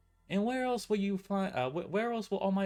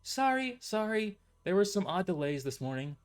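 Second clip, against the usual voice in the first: somewhat remorseful; 8 semitones higher than usual. A narrator's reading. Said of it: "trying to sound somewhat remorseful".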